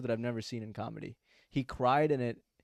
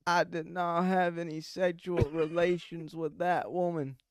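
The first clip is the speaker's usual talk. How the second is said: slow, higher, badly faked presidential denial; Bill Clinton; 5 semitones higher, slower than usual. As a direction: do a poor politician impression, drawn out and raised in pitch. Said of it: "here's my Bill Clinton impression".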